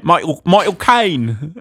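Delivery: exaggerated Cockney star impression; Michael Caine. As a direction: say it in a drawn-out mock Cockney accent. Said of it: "say it in his accent".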